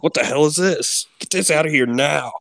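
outraged body voice. Retold or imitated. Body voiced rejecting juice.

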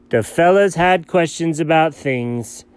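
ordinary talk

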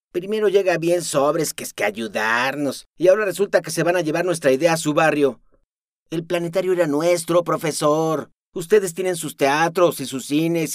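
loud, high, furious outburst with rising heat; angry rant. Acted. Aris yelling at the professor, furious. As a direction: spit every word of the grievance at him.

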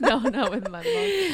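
says it through a laugh; laughing echo, slow. Real, not acted. Echoing joke, laughing.

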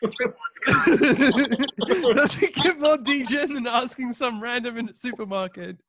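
giggling disbelief; laughing. Real, not acted. Laughing at the bet.

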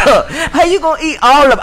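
high, laughing disbelief; laughing retort. Retold or imitated. Friend retelling his own retort to buddy, laughing high. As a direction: laugh through the question. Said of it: "I said".

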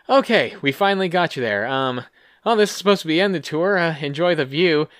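high, quick cheery guide voice, hammed-up; mock tour guide. Pitching a ride, mock tour guide voice. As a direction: play a flustered ride host wrapping up.

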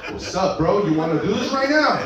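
mock tough guy. Imitating tough guy at road rage.

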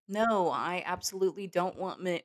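mock voters. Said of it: "innocent people saying".

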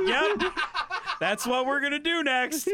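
excited high laughing voice. Retold or imitated. Imagined group chat member.

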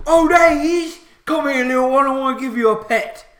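very loud, high orc calling a dog; delighted orc. Acted. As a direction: call a dog over excitedly as an orc. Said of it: "the one orc says".

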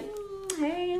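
high, soft flirty coo; playful soft come-on; flirty hello. Retold or imitated. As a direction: coo it at a crush. Acted flirty greeting.

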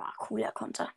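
whispered aside. Real, not acted. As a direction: breathe it under your breath.

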